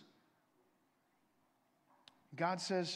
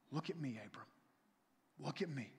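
softer, lower reassurance; tender God voice; 4 semitones lower, 8 dB quieter, faster than usual. Acted. God comforting a fearful Abram, soft and tender. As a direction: gently draw his eyes to you.

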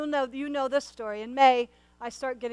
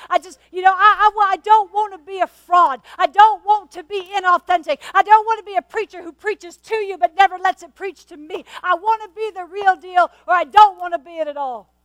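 higher, louder, pleading with full conviction; impassioned plea; 5 semitones higher, 8 dB louder than usual. Real, not acted. Preacher to congregation, passionately insisting on sincerity. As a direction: insist you won't be a fraud, voice climbing.

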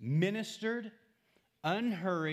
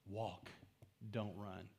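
soft, low, unhurried advice; hushed, low-pitched reminder; gentle reminder; 5 semitones lower, 10 dB quieter, slower than usual. Shown as a demonstration. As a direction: say it quietly and lower, letting it settle. Same line repeated softly as advice.

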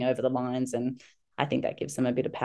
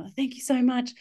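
higher, softened-edge thanks; imitated grateful aside; 9 semitones higher than usual. Mum winking at the girl who saved her, higher grateful voice. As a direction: thank a small rescuer in a higher voice. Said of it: "give her a little wink".

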